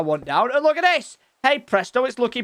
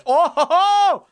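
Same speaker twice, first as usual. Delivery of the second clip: gleeful reveal; 11 semitones higher, 6 dB louder, much slower than usual. Real, not acted. Proud reveal of new pattern.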